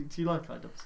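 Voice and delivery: normal talking voice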